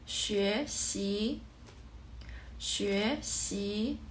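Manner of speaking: "Xue xi" with each syllable rising; second tone. Shown as a demonstration. Teacher gives second-tone examples, "xue xi" rising on both syllables. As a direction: lift both "xue" and "xi" upward. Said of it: "the second tone is raised".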